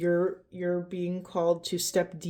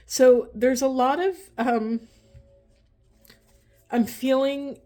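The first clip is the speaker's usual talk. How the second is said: choked up; 5 semitones higher, 4 dB louder than usual. Real, not acted. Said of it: "closing my throat".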